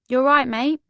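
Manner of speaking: put-on light London accent, friendly; a casual London greeting; breezy London mate. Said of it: "in a typically London voice".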